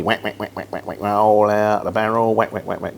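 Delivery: caricatured Cockney market-trader patter; mock Mockney. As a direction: imitate a Cockney barrow-boy with quacking filler sounds. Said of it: "so you get the Mockney".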